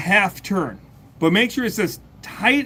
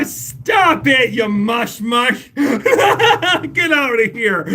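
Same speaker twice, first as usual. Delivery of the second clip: high, slow laughing protest; bashfully waving off the praise, laughing; flustered laughing; 7 semitones higher, 4 dB louder, much slower than usual. Real, not acted. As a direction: laugh off the compliment, drawing it out. Embarrassed by viewer's praise.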